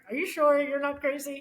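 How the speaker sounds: skeptical lawyer dad. Retold or imitated. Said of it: "you know, like".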